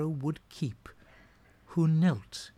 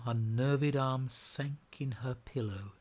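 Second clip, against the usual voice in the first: ordinary delivery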